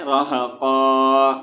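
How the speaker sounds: "रहक़ा" slowly, last vowel held long; pausal reading. Shown as a demonstration. Teacher showing how to read at a stop, pausal "रहक़ा". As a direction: draw out the final open aa of "रहक़ा". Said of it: "यहाँ पर क्या पढ़ना है".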